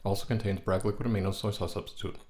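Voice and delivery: quick disclaimer read; fast small print. Shown as a demonstration. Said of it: "secret ingredient that they're not quite wanting to tell you".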